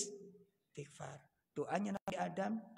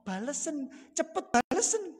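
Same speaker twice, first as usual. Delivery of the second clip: pleading Abu Bakar; 5 semitones higher, 3 dB louder than usual. Retold or imitated. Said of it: "langsung Abu Bakar bilang".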